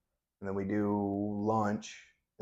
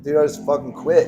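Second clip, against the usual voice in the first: exasperated outburst; 6 semitones higher, 12 dB louder than usual. Retold or imitated. Exasperated retort to instructor.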